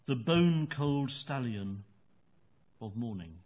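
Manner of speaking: slow, measured recital with long pauses between phrases; poem reading. Acted. Poet reads his own poem aloud in the interview, slow and measured. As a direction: let each image hang in the silence.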